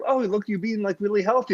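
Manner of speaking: high chirpy host voice imitation; mock TV host. Imitating the cheery morning show host to him, chirpy. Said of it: "this woman's like".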